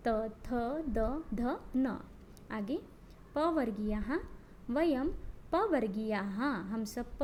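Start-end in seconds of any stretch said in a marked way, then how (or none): 0.1-2.0 त, थ, द, ध, न each said slowly, tongue on the teeth; slow demo of dental letters. Shown as a demonstration. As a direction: spell out त थ द ध न slowly for students to repeat. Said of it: "कहिए". (दंत्य वर्ण धीरे-धीरे बोलकर दिखाना)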